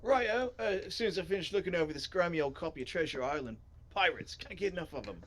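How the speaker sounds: jaunty put-on British-style voice; keen bookworm character. Reader as a character engrossed in Treasure Island, keen voice. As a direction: start high on a cheery call, then settle.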